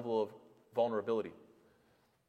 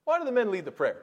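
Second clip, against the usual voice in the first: put-on higher, louder voice of a curious young girl; a little girl asking the sheikh about the prayer, higher and louder; 7 semitones higher, 4 dB louder than usual. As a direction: ask it like a puzzled little girl. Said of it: "She goes".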